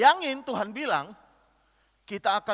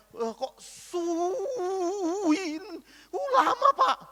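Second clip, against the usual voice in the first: whiny complaint; 10 semitones higher, much slower than usual. Retold or imitated. Bored churchgoer whining about length.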